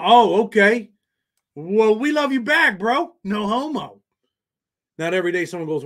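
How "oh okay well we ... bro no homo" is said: higher and slow, mock-sweet; teasing. Streamer teasingly coos back at a chat viewer who loves him. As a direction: coo it back with a playful wink.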